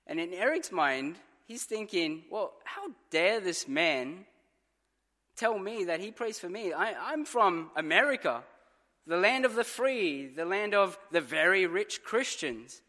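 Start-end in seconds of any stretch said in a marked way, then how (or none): 2.3-12.8 indignant. Retold or imitated. Eric's indignant thoughts retold.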